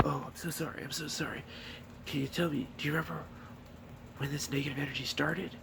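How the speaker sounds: a hint of a fawning guru calming her; apologetic cult leader. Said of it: "and this guy".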